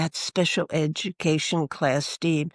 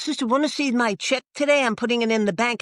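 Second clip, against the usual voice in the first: higher, proud and eager; voicing the student; 5 semitones higher, faster than usual. Student shows the sister his paycheck, higher and proud. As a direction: show off the paycheck like an excited kid.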